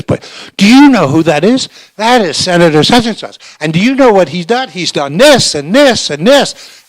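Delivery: high, loud, indignant outburst; enraged assistant. Retold or imitated. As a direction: sputter with outrage at the waiter's nerve. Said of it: "the guy is just enraged".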